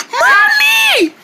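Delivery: high loud call for Mommy. Acted. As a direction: yell for Mommy from afar. Calling for mother.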